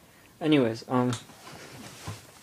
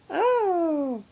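dog voice. Real, not acted. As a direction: coo at the puppy up high. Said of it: "That's my dog voice".

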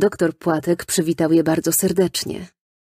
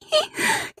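put-on high squealing child's laugh; squealing giggle. A narrator's reading. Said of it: "Kwiczała dziewczynka i skręcała się ze śmiechu". (piskliwy dziecięcy chichot)

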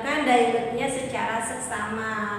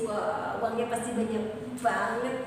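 usual speaking voice

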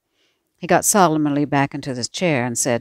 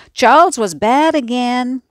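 yelling; 9 semitones higher, 7 dB louder than usual. A narrator's reading. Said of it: "Laurie yelled all the way".

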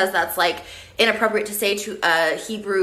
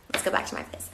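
breathy whispered invite, much lower and softer; sultry whisper; 8 dB quieter, faster than usual. Acted. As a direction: whisper it like a come-on. Whispered seductive line.

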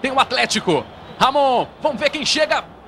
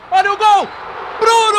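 sharp high shout of the name; excited shout; 9 semitones higher, 3 dB louder than usual. Acted. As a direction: yell the keeper's name. Keeper's save, called out.

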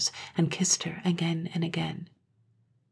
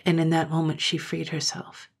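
plain reading voice

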